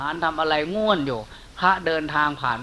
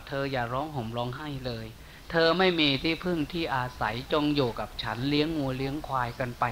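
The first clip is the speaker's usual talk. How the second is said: soothing consolation; 3 semitones lower, 8 dB quieter than usual. Acted. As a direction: hush her tears with a soft, kind voice. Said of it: "ปลอบใจนางนั้นก่อหยุดร้องไห้".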